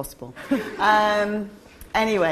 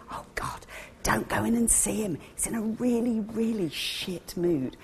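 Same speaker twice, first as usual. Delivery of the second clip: hushed, conspiratorial whisper, softer; whispered warning; 3 semitones higher, 8 dB quieter than usual. Retold or imitated. Colleague warning a friend not to see the boss, whispered. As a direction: lean in and warn under your breath.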